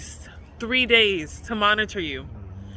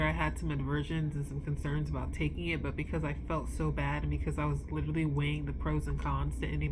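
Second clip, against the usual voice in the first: much softer, subdued morning update; calm and stable; 5 semitones lower, 9 dB quieter than usual. Real, not acted. Vlogger telling viewers the new meds work, quietly calm. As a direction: speak low and drained but steady. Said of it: "I feel stable".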